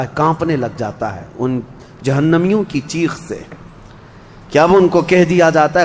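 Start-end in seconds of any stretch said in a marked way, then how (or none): none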